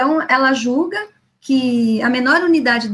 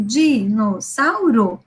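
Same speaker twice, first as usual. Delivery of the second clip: put-on Dinossauro read slowly, syllable by syllable, like a child; 2 semitones lower, much slower than usual. Shown as a demonstration. Teacher showing how a child reads, slow and split into syllables. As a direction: stretch Dinossauro out, one syllable at a time. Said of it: "ela vai passar do E pro I junto".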